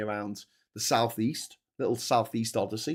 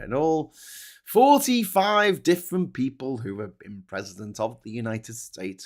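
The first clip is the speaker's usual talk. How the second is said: ordinary talk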